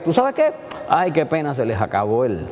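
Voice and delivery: a mother hinting to her son that the wine is gone; intimate confiding. Shown as a demonstration. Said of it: "No, María dijo".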